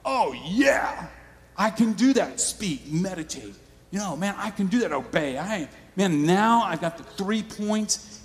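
a touch of mock overconfidence; mock eager listener. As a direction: play the fired-up churchgoer. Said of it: "And you're like".

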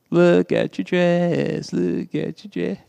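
slow taunting singsong, lightly voiced; mocking schoolgirls. Preacher voicing schoolgirls mocking a girl's dress, taunting. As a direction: tease like mean little girls. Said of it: "they point at her and they start laughing".

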